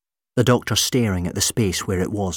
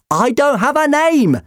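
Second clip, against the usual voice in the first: high, loud desperate shout; 7 dB louder than usual. Acted. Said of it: "the doctor shouted".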